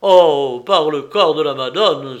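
loud, shocked oath from a father; loud exclamation. A narrator's reading. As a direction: cry out the oath at full voice. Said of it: "s'écria Giacomo".

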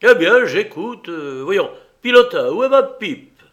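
loud, high, irritated reply; brusque shout to a cabin boy; impatient captain. A narrator's reading. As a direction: humour him impatiently, then call out. Impatient captain answers the passenger.

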